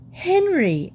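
high-pitched, scandalised cry of a name; appalled, pitched right up; shocked exclamation. Acted. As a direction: call the name out in alarm. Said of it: "exclaimed Missus Brown".

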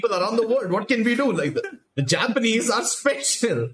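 imitating someone making excuses; mock excuse-making. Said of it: "they were like".

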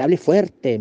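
imitating the preceptor's sharp demand; a hint of a stern teacher barking; loud command. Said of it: "me decía así".

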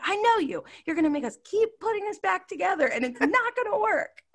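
high, wailing whiny husband; mock husband. Husband fed up with rebuilding, high wailing mock whine. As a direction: whine it like he's about to cry. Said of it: "And he's like".

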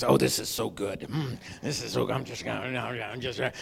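low contented mumble, savoring; dog muttering over a bone. Pretend. Dog savoring its bone, mumbling to itself. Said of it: "he kinda mutters to himself".

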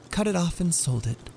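put-on high young woman's voice. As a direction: answer brightly but nervous. Della answers Jim.

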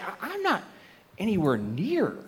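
almost whisper the realization; hushed realization. Retold or imitated. Believer realizing they're far from God, hushed dismay. Inner realization of being lost.

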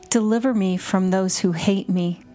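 ordinary delivery

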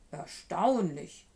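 slow, loud monster voice; drawn-out monster marvelling; amazed Gruffalo. A narrator's reading. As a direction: draw the word out in amazement.

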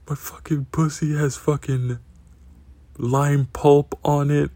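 hammed-up disgust, dragging out lime pulp; mock outraged girlfriend. Girlfriend complains, mortified.

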